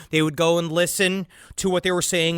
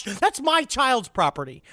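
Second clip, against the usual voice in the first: slow, higher-pitched indignation; mock outraged parent; 6 semitones higher, slower than usual. Parent confronting the Tooth Fairy at home, mock outrage. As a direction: play at confronting an intruder, drawn out.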